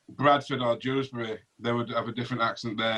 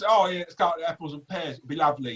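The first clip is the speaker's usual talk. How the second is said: mock Cockney, pitched up at first; quick Cockney caricature; Cockney accent; 4 semitones higher than usual. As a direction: put on a chirpy Cockney accent. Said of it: "not exactly a Cockney accent".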